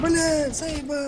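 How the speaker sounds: loud, high frustrated outburst; angry yell. Real, not acted. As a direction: blow up at the game. Frustrated outburst after dying.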